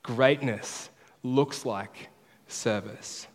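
Greatness looks like service, each word drawn out very slowly; very slow, deliberate refrain; very slow emphatic. Shown as a demonstration. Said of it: "that's the big idea".

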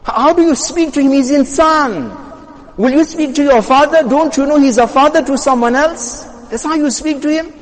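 high-pitched indignant questioning; acted scolding. Scolding someone for how he spoke, indignant reproach. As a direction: take them to task, pitch held up high.

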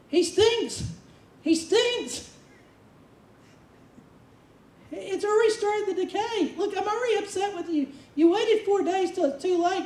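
high-pitched, upset mock protest; protesting Martha. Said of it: "guess who starts protesting?".

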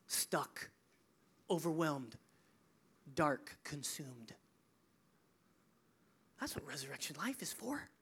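soft, low, nearly whispered; hushed solemnity. Real, not acted. Preacher naming those who are stuck and overwhelmed, hushed. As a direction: let each word sink in, hushed, with long pauses.

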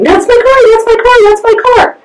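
high, gleeful yelled repeat; excited screaming. Retold or imitated. Hearing the car's mileage from her sister, high and thrilled. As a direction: leap off the couch overjoyed. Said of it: "started screaming".

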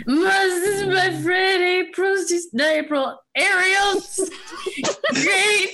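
hammed-up sobbing to his mom; mock bawling. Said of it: "just bawling".

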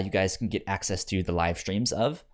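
ordinary delivery

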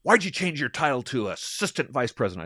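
confrontational. Retold or imitated. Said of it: "leans into my face and says".

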